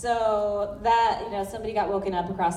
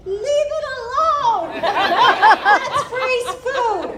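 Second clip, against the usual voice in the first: high, slow shout at the raccoon; yelling; 12 semitones higher, 6 dB louder, much slower than usual. Retold or imitated. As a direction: yell it out, high and drawn out. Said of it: "and I was like".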